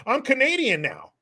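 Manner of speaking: high-pitched mock proclamation; naive mock announcement. Newcomer walking into Canada, naive high-pitched announcement.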